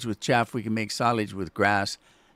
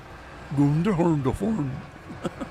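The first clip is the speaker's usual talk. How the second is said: failed Swedish Chef impression; 4 semitones higher than usual. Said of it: "I can't do it".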